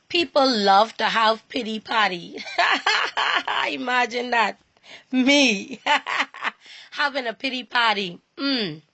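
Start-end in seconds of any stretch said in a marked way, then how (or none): 2.4-3.6 high rippling laugh; amused laugh. Real, not acted. Laughs at her own pity party. 5.9-6.5 short higher chuckle; brief laugh at yourself; quick self-mocking giggle; amused laugh. Real, not acted. Laughs at her own pity party.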